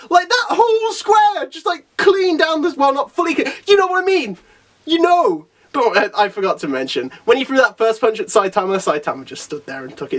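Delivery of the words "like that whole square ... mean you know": high-pitched, excited insistence; flabbergasted. Real, not acted. Amazed explaining of the feat.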